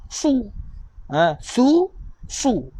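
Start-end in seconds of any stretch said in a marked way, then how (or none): 0.1-0.5 "Su, su" heavy and emphatic, tongue raised; thick su. Shown as a demonstration. Teacher showing the thicker sad sound. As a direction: make "su" thick and dark. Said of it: "Sad baris depan".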